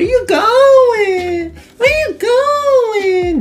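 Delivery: very high, slow sing-song taunt; mocking taunt. Real, not acted. Gamer taunting a defender he just beat, slow high sing-song. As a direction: tease the beaten defender.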